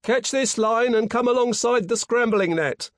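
high, loud call from the forecastle; yell. A narrator's reading. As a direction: yell instructions down to men in the water. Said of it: "yelled a voice on the forecastle".

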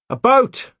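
high, loud urgent shout; calling out. A narrator's reading. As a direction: shout the news down from afar. Calling out news from the gallery.

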